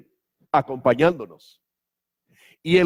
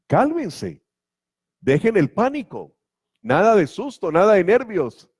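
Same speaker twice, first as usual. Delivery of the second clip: higher, urgent reassurance; urging calm; 6 semitones higher than usual. Acted. Jesus tells the frightened disciples to calm down, higher and urgent. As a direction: urge them out of their panic.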